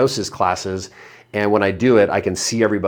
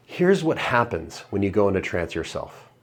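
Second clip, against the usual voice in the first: ordinary talk, nothing marked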